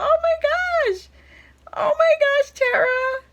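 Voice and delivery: very high, slow, emotional oh my gosh; overjoyed squeal. Real, not acted. Vlogger overwhelmed by a gift from her friend Tara, high squeal. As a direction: squeal with happy tears close. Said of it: "I'm all up in my emotions today".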